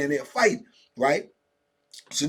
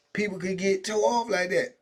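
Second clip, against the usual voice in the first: usual speaking voice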